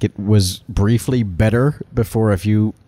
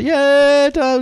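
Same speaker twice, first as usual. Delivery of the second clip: mock cheer.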